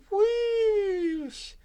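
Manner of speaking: very high loud whooshing cry; ghostly whistle. A narrator's reading. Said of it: "his ghostly whistle".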